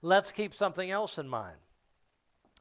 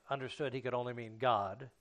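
ordinary talk, nothing marked

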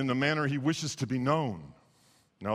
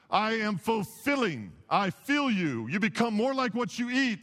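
high-pitched, emphatic sermon delivery; driving pulpit declaration; impassioned preaching; 8 semitones higher than usual. Real, not acted. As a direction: proclaim each claim like a preacher in full flow. Preaches Christ's sayings at full force.

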